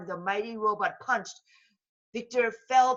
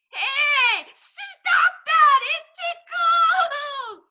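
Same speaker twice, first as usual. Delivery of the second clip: very high, loud, slow giggly protest; villain vulture being tickled; laughing vulture; 12 dB louder, much slower than usual. Acted. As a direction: squeal through the tickles. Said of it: "laughed Victor Von Vulture".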